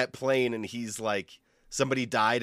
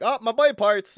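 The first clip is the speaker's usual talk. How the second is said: high-pitched awkward blurt; retold awkward patient; 11 semitones higher than usual. Patient telling the doctor about boy parts, high awkward blurt. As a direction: pitch it way up, mock sheepish. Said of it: "I was just like".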